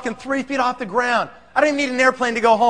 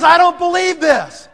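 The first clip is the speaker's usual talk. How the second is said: high, loud, slow amazement; disbelief; 8 semitones higher, 7 dB louder, slower than usual. Retold or imitated. Client amazed by the product, acted disbelief. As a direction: act out his astonishment, stretching the words.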